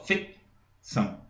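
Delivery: "Fecção" slowly split as FEC, pause, ÇÃO; syllable by syllable. Shown as a demonstration. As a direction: break "Fecção" into two separate syllables. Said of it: "Eu jogo de uma vez só".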